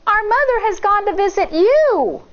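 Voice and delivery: put-on high little girl explaining; high young girl voice; child's voice. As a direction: speak like a small child. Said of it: "Shang said".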